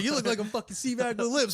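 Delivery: high, loud mock insult; hammed-up bawling; berating drill instructor. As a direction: yell it like a retold drill-instructor roast.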